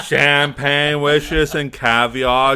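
slow, grandly stretched host catchphrase; Robin Leach. Acted. Friend delivers the TV host's sign-off, slow mock-grand. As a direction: draw it out like Leach. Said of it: "What did Robin Leach say?".

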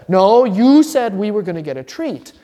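whiny protest. Retold or imitated. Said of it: "the son says".

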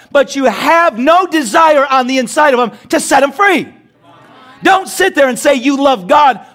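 high, forceful preaching at full stretch; fired-up preacher in full flow; impassioned preaching. Real, not acted. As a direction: drive the challenge home to the congregation.

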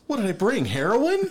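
high, loud incredulous comeback; mock outrage. Guest scolded for bringing canned food, incredulous. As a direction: protest it hammed-up and high-pitched.

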